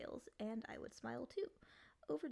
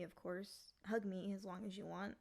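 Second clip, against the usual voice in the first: usual speaking voice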